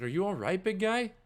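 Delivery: high, loud awkward concern; awkward comforting. Acted. Guard awkwardly comforting the crying man. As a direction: try to soothe him, clumsily.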